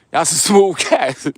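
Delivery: chuckles through the words; laughing as he repeats it. Real, not acted. Teasing DC guys who stole girl.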